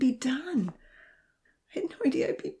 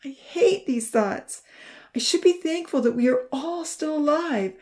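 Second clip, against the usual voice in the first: high, louder, upset voice on the edge of tears; tearful; 8 semitones higher, 6 dB louder than usual. A narrator's reading. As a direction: fight back tears while talking. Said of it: "choking on another sob".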